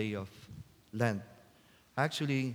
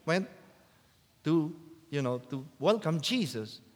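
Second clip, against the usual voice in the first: usual speaking voice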